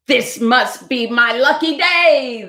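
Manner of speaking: high, slow jubilant cry; shouting fox. Acted. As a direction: shout it slowly in triumph. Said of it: "the fox shouted".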